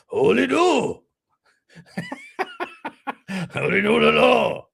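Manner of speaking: lightly put-on Japanese accent, half-laughing; Japanese impression. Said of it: "That's more of a Japanese impression".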